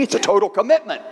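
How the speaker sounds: quick punchline delivery; protesting pig. Acted. Pig objecting to chicken's breakfast plan, quick protest. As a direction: toss it out fast as the joke's payoff. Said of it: "the little pig looks at the chicken".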